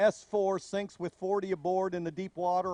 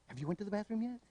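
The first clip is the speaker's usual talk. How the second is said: much softer, matter-of-fact mom; mother's stock reply; 11 dB quieter, much faster than usual. Retold or imitated. Mother answering her child's complaint, soft and matter-of-fact. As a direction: ask it like a weary mother.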